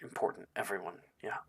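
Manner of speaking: soft, hushed and fast; leaning in quietly. Acted. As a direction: murmur it close so nobody hears. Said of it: "lean over toward you and say".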